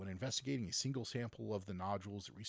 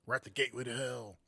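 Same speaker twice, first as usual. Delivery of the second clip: announcer shout; 5 semitones higher, 6 dB louder, faster than usual. Pretend. Mock announcing the song.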